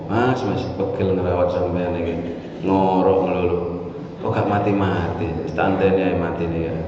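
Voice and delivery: lightly voiced wife muttering low and soft; a hint of a fed-up wife complaining; grumbling wife. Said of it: "Ngedumel, ngomel".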